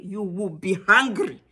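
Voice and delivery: ordinary delivery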